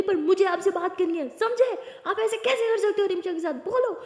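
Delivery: high, loud furious yelling; angry shout. Acted. Aliya shouting at her uncle in the hotel lobby, furious. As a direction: scream the accusation at him. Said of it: "तो बहुत ऊंचा चिल्लाने लगती है".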